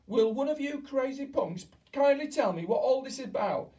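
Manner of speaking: put-on high, loud angry boy's shout. A narrator's reading. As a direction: yell it at the crazy crowd. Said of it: "shouted Bruno".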